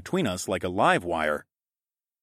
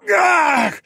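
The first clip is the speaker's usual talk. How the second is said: high, loud roar of effort; effortful yell; straining roar; 8 dB louder than usual. Acted. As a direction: roar while reaching with all your might. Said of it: "I roared as I reached hard".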